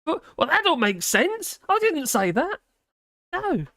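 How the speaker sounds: high, slow, mock outrage; mock indignant. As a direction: protest in a squeaky huff. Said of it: "Google Docs get really confused".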